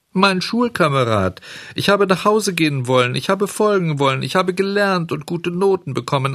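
high-pitched, agitated self-justification; Bengele. A narrator's reading. (aufgeregt und hoch, sich rechtfertigend)

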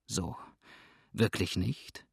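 scornful understatement; sneering. A narrator's reading. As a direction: jeer quietly, with false surprise. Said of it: "höhnte Cotton".

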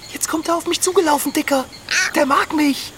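high, thrilled and eager; delighted the koala is coming to her; excited. Acted. Koala runs toward her. (aufgeregt und entzückt, hoch)

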